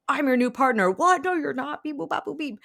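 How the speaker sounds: high-pitched, quick-fire mock squabble; mock bantering. Demon and witch argue over partnering, hammed-up high banter. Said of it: "bantering and are like".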